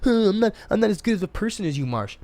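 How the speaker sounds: high, lightly voiced excuse; mock whiny friend. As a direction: play the friend dodging with a whine. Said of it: "friend's excuse quoted".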